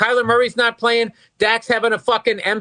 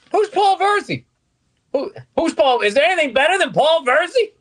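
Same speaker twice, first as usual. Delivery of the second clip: higher, indignant wife voice; mock outraged wife; 8 semitones higher than usual. Wife hears the gambling confession, mock indignation. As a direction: play the shocked spouse.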